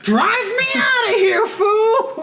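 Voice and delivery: very high, loud hammed-up shriek; mock frantic scream. Gangster to driver as police cars explode, mock frantic scream. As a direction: scream the getaway order, comically over the top.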